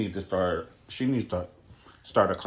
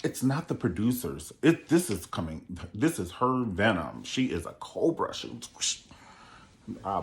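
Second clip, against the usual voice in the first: usual speaking voice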